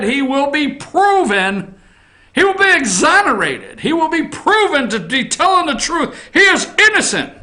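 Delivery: mocking his protest. Mocking Avenatti's claims.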